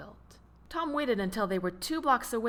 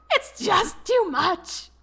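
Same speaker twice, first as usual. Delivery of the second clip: higher, louder, slow laughing squeak; 12 semitones higher, 7 dB louder, slower than usual. A narrator's reading. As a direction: still helpless with laughter, a bit less high. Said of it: "he squeaked out".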